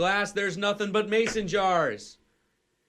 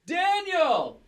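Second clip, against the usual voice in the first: calling out; 12 semitones higher, 4 dB louder than usual. Retold or imitated. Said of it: "and Katie goes".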